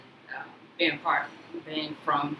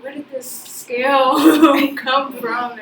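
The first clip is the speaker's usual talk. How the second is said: slow, higher, louder, laughing; retold disbelief; 9 semitones higher, 10 dB louder, much slower than usual. Speaker asking where the bougie scale came from, laughing. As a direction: stretch it out in amused disbelief. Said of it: "I was like".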